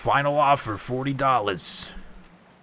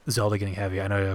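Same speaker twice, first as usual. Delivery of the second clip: ordinary talk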